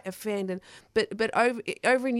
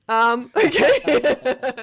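slow, loud, bemused laugh into okay; a baffled laugh, stretched out; 4 semitones higher, 11 dB louder, much slower than usual. Retold or imitated. Poet reacting to the gig host's answer, laughing in disbelief. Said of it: "And I'm like".

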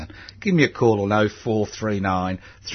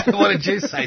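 high, louder laughing question; 10 semitones higher, 5 dB louder than usual. Real, not acted. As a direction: ask it while still laughing. Host laughing at guest's story.

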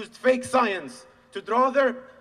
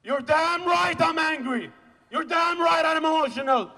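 enraged; 7 semitones higher than usual. Real, not acted. Speaker answering those who call them angry, enraged shout. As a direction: hurl each short line out in real rage. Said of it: "I'm enraged".

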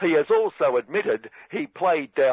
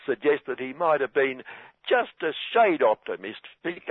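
normal talking voice

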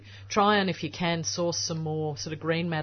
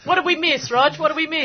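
excited; 10 semitones higher, 8 dB louder than usual. Real, not acted. Teasing the caller Roger.

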